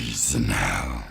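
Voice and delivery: extremely deep, slow fighter voice; exaggerated deep scary impression; Sub-Zero. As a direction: drop to the bottom and drag out each word. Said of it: "it's so deep".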